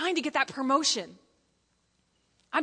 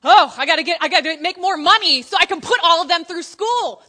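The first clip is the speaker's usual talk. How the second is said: loud, frantic hammed-up worry; a parent panicking about money; mock stressed parent; 4 semitones higher, 12 dB louder than usual. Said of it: "when you finally have the kids".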